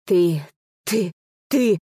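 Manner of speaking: choked, sputtering repetition; indignant. A narrator's reading. Brother finds no answer to the insolence, sputtering indignantly. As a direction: stammer as if outrage blocks the words. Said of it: "едва не задохнулся от возмущения".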